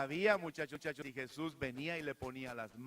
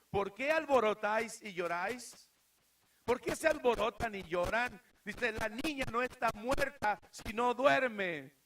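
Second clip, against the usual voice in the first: commanding rebuke; 7 semitones higher, 8 dB louder than usual. Acted. Jesus loudly rebukes the mourners at the house. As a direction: call over the wailing crowd with authority.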